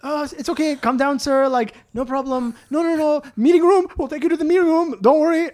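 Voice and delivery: high, hurried mock reassurance; pleading, high-pitched calming patter; placating bank staff. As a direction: imitate flustered employees soothing a customer. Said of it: "the employees in the bank now are like".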